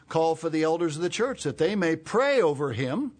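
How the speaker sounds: high, strong Bible reading; scripture reading. Real, not acted. Preacher reads James to the congregation, forceful scripture reading. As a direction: read the verse out with force.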